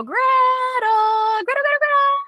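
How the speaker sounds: high, shrill-free repeated name calling; mock the frantic owner calling again and again; high nagging recall of the dog's name. Shown as a demonstration. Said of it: "I'm not gonna go".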